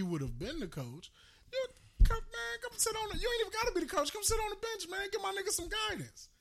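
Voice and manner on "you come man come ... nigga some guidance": pleading mock call. Voicing plea to Phil Jackson.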